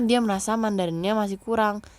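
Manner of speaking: ordinary talk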